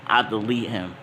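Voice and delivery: ordinary delivery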